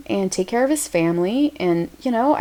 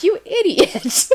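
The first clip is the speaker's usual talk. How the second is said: imitating English listener; 10 semitones higher, slower than usual. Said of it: "They probably are like".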